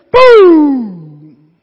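loud, drawn-out boom; booming shot sound; gunshot boom. Acted. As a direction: blast it like a rifle shot. Rifle shot.

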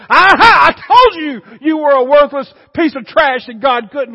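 gloating accusing Satan. Retold or imitated. Satan mocking the fallen believer, loud sneer. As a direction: turn on them with a cruel shout. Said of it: "Satan does a double take and says".

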